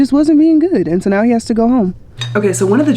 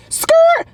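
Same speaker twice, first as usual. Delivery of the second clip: very high imitated tire screech; screeching halt noise. Dog owner cutting off the peanut gallery, high mock screech. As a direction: squeal it like brakes slammed on. Cuts off the mocked onlookers.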